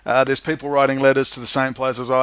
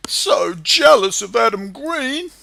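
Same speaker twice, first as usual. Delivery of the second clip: slow, louder, higher mock jealousy; sarcastic; 8 semitones higher, 5 dB louder, slower than usual. Mocking his rival Adam Green with hammed-up, slow sarcasm.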